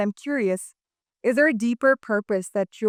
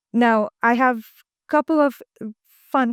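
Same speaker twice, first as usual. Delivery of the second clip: playful build-up; 3 semitones higher, 5 dB louder than usual. Real, not acted. Host teasing the guests about the fun questions, with excitement. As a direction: lift it up like announcing a treat.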